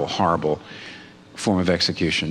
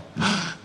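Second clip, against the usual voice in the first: gasp; 8 semitones higher, 5 dB quieter than usual. Pretend. Mock astonished reaction to the analogy.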